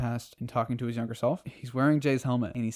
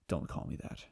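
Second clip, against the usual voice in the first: softer, whispered rebuke; cold whisper; 10 dB quieter than usual. Retold or imitated. Barry rejecting the Flash name, cold whisper. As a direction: shut him down in a whisper. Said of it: "And Barry's like".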